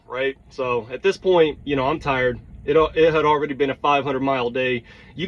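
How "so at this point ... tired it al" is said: ordinary delivery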